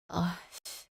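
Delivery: a soft, choked sob under the breath. Acted. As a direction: swallow a curse through tears. Mother-in-law cries after being thrown out. (울음 섞인 작은 흐느낌)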